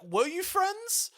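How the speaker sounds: slow, high-pitched disbelief; incredulous question. Acted. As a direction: draw it out, rising in mock doubt. Doubting the heroine's claimed friendship.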